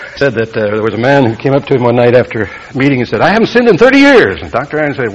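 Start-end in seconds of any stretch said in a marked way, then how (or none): 3.2-4.4 high, loud mock boast; emphatic boast. Man boasting to Doctor Ironside after a meeting, emphatic mock boast. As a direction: imitate a man proudly proclaiming it, pitched way up.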